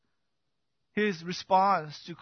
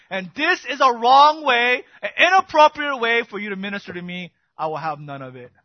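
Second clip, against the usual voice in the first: indignant; 5 semitones higher, 10 dB louder than usual. Acted. Peter's indignant refusal continues.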